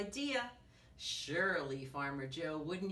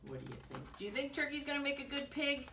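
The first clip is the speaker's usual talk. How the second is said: usual speaking voice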